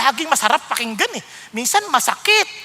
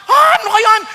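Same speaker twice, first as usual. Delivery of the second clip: loud, high indignant retort; mock angry shout; 9 semitones higher, 9 dB louder than usual. Angry listener shouting back at the sermon, loud and high. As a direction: shout the protest back at the preacher.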